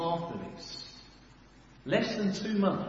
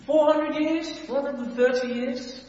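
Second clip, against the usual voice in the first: excited; 9 semitones higher, 6 dB louder than usual. Real, not acted. Rhetorical excitement.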